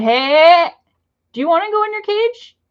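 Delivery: high, loud warning cry; sharp scolding. Real, not acted. Owner scolds her bird Connor, sharp high warning. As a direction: snap at the bird to stop now.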